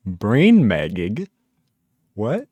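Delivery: slow, drawn-out "Brain magging" rising in confusion; baffled question. Real, not acted. Streamer baffled by a chat word, slow puzzled question. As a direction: say it like the word makes no sense.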